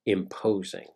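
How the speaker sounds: hushed suspense narration. A narrator's reading. Storyteller opening a monster tale to his audience, hushed and eerie. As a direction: tell it like a campfire ghost story, barely above a murmur.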